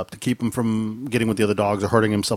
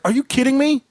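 exasperated; 11 semitones higher than usual. Retold or imitated. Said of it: "I was like".

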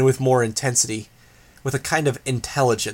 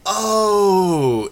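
Imp's teasing oh; 7 semitones higher, 3 dB louder than usual. A narrator's reading. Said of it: "Imp cut in".

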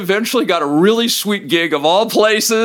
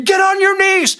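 a third cop screaming a conflicting order; 4 dB louder than usual. Retold or imitated. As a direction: order shouted much higher and louder. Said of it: "the other cop is screaming".

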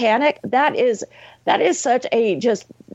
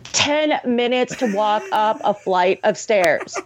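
usual speaking voice

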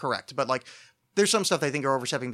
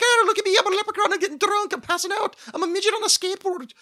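very high, loud leprechaun caricature; mock drunk leprechaun; 6 dB louder than usual. Comic mocks drunk people dressed in leprechaun costume, mock leprechaun voice. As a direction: squeal it out like a hammed-up drunken leprechaun. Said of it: "like, leprechaun costume".

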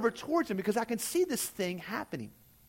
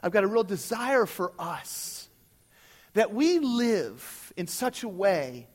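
earnest sermon appeal. Real, not acted. Pastoral appeal to church.

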